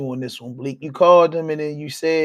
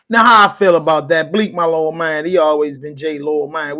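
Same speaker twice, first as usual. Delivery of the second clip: much louder, emphatic opinion; loud reaction; 9 dB louder than usual. Real, not acted. Vlogger loudly weighing in after the clip. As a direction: lean in and project it.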